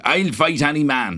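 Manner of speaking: bad American impression of an Irish fighter; high, exaggerated Dublin accent; mock a terrible Irish-accent impression; Conor McGregor. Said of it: "people doing impressions of Conor McGregor".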